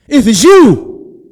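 high, loud, stretched-out call. Acted. Peter asking Jesus to summon him, calling out. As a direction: shout it slowly across a distance.

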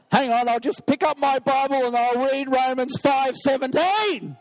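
loud, high rallying cry; triumphant shout. Acted. The believer answers the demon with Scripture, shouting triumphantly. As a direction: shout it like pulling out a sword. Man fights back with Bible.

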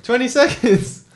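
very high, loud yelling; shocked excitement. Real, not acted. Friend watching the can steam up, shocked excitement. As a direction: exclaim in real giddy alarm.

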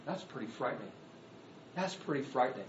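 usual speaking voice

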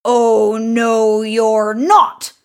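loud troll refusal; forceful monster voice, drawn out; big hairy troll. A narrator's reading. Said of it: "said the big hairy troll".

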